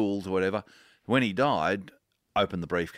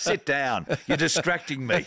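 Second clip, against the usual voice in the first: mock stern teacher, pitched higher; imitating a teacher; 8 semitones higher than usual. Grade three teacher scolding a distracted pupil, mock stern and higher. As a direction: playfully ham up a scolding schoolteacher. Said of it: "what my grade three teacher said to me".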